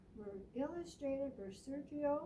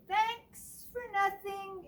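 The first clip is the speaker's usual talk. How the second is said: high, loud slow cheer; 8 semitones higher, 7 dB louder, slower than usual. Acted. Mice cheering at the end, loud. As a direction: cheer it together. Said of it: "they say".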